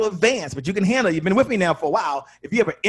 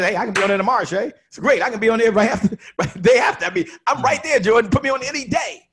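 higher and louder, bursting to get booked; eager excitement; 7 semitones higher, 6 dB louder than usual. Retold or imitated. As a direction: play the guest who can't wait to be on the show. Eager prospect begging to be booked.

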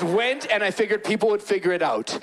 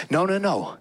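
ordinary talk, nothing marked

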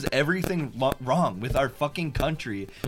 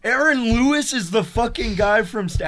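excited; 12 semitones higher, 7 dB louder, slower than usual. Retold or imitated. Excited recognition of band.

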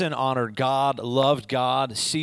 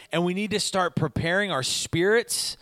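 usual speaking voice

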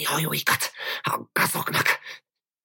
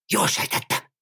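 whisper. Acted. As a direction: keep whispering, triumphant. Continues whispering after suttogta.

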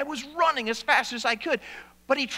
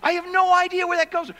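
put-on child's panic; 8 semitones higher than usual. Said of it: "I was sitting there in bed thinking".

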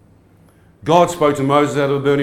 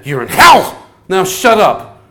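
loud, higher demon yell; demon shouting; 6 semitones higher, 8 dB louder, slower than usual. Retold or imitated. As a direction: yell it down at him like a tormentor. Demon shouting at him in hell.

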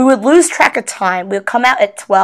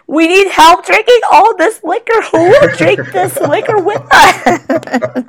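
playful tipsy plea; 9 semitones higher than usual. Retold or imitated. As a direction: beg giddily, half laughing.

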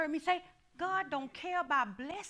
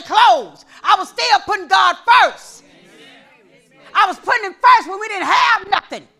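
loud, higher, driving sermon climax; a pastor shouting testimony, fired-up preaching; preaching at full force; 8 semitones higher, 18 dB louder than usual. Acted. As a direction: preach it hard to the congregation.

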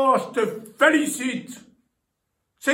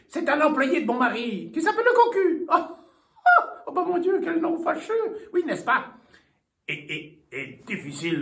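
ordinary talk

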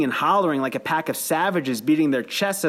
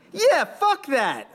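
much higher and slow, a second voice; echoing voice; 13 semitones higher, much slower than usual. A narrator's reading. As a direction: drag it out like someone joining the chant late. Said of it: "another voice echoed".

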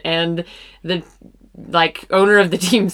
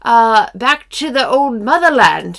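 higher-pitched mock British delivery; put-on British accent; 6 semitones higher, 4 dB louder than usual. Co-host hinting at a move to London, put-on British accent. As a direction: keep up a hammed-up London accent, halting as you go.

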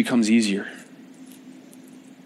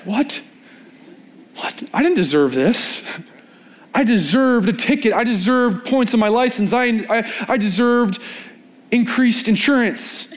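stunned disbelief; 9 semitones higher than usual. Acted. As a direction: blurt it out like you can't believe your luck. Fassungsloser Fahrer beim Polizisten.

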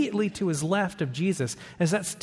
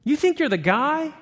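teasing challenge; 8 semitones higher, 4 dB louder than usual. Acted. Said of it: "Jesus gets real with Peter".

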